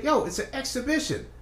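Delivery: high, pleading yo, it's an exhibition; incredulous protest. Retold or imitated. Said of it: "I was like".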